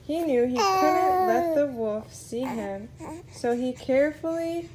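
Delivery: plain reading voice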